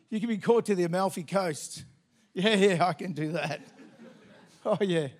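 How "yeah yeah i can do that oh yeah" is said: ordinary talk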